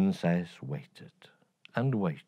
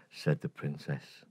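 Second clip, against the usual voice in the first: plain reading voice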